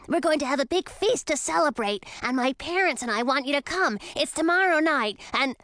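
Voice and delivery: put-on boyish dragon inviting a friend; a lightly voiced eager kid dragon; eager young dragon. A narrator's reading. Young dragon invites Emma to a feast, eager.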